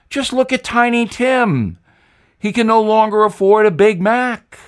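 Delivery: a ghostly Southern warning to Joe; Jimmy Carter. Acted. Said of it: "I'd know that accent anywhere".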